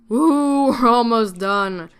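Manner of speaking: loud woo then slow laugh; cheer of relief. Real, not acted. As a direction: celebrate almost being done. Relief near end of list.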